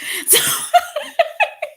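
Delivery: sudden high-pitched burst of laughter. Real, not acted. Mom laughs about her son leaving with no shoes, high-pitched. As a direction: crack up at the memory.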